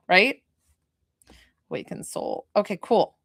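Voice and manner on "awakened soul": hushed whisper, reverent. Real, not acted. Telling the sitter her name's meaning in a whisper. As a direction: breathe it out like a revelation. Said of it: "repeating meaning".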